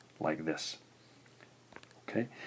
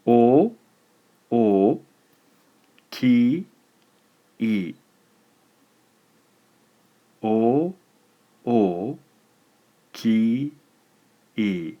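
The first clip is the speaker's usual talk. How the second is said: syllables set apart slowly and louder, each one apart; slow dictation; 3 semitones higher, 7 dB louder, much slower than usual. Shown as a demonstration. Teacher dictating syllables one by one, louder, for students to write. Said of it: "Write down what you hear".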